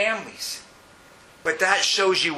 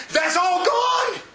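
shout; much slower than usual. Real, not acted. Preacher shouts the point.

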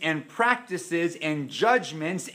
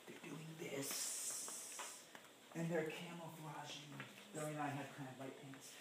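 much softer, hushed whisper; hushed, secretive whisper; creeping whisper; 21 dB quieter than usual. Acted. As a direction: sneak the words like a creeping fox. Describing foxes creeping in.

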